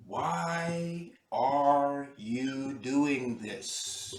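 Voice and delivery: very slow, drawn-out gremlin question; slow, deliberate otherworldly questioning. Acted. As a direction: stretch each word out as a creature addressing a pilot.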